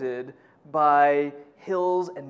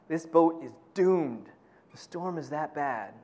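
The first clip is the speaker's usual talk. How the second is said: ordinary talk, nothing marked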